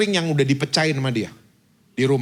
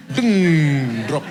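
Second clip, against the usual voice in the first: laughing; 3 dB louder than usual. Real, not acted. Laughs at his own joke.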